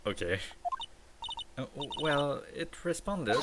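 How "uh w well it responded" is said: higher-pitched, halting reply; timid character voice, stammering; hesitant stammer. Acted. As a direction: stumble over the words, pitched up. Startled character stammers after popper bang.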